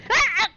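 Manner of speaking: sudden loud frightened yelp; startled scream. Real, not acted. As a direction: jump at the noise and yell. Explosion scares player.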